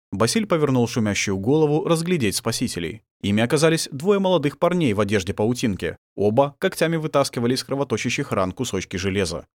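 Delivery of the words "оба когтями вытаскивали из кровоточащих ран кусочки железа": ordinary delivery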